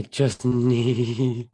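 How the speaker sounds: slow mock quote, breaking into a laugh; laughing mock repeat. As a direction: parrot the line, cracking up halfway. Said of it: "repeating the Trump quote".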